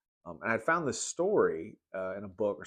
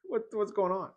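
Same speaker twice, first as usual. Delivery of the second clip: mock bewilderment; 12 semitones higher than usual. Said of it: "I was like".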